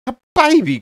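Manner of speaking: high, loud delighted squeal; a tree thrilled by a baby on the way; excited cry. A narrator's reading. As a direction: burst out with joy at the news. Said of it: "cried Tina".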